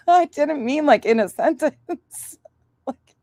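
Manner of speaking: high, giggling through the words; laughing out the correction. Real, not acted. Mother correcting her daughter's cussing rule, high and giggling.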